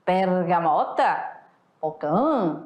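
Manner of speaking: mock puzzled regional words; regional word variants. Shown as a demonstration. Teacher voicing students' regional words. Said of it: "você fala o quê?".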